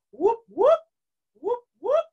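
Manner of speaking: high rising whoops like a siren; cop siren whoop. Shown as a demonstration. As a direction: sweep up like a police siren. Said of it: "a siren like this".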